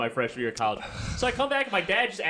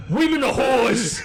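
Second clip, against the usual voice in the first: slow, high, loud New Jersey dad; New Jersey accent; 8 semitones higher, 7 dB louder, slower than usual. Retold or imitated. Dad lecturing son in loud New Jersey accent. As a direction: drag it out like a blowhard Jersey dad. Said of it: "I also gave him a New Jersey accent".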